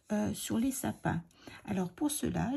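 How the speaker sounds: usual speaking voice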